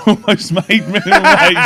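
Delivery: real laughter bursting through the words; laughing. Real, not acted. Laughing while echoing co-host's line.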